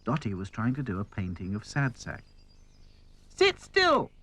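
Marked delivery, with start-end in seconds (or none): 3.3-4.1 high-pitched, loud order; exasperated high-voiced call. A narrator's reading. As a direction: call it out sharply in a high doll voice. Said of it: "she called".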